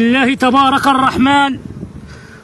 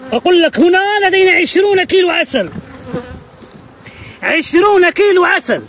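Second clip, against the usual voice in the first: high, insistent exclamation; excited boast; 6 semitones higher than usual. Real, not acted. Beekeeper telling viewers the honey yield, excited. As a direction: announce the haul with raised pitch.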